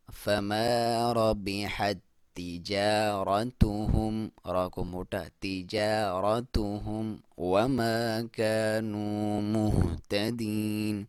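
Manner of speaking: slow, drawn-out melodic tilawat; slow Quranic recitation. Shown as a demonstration. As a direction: chant it long and measured.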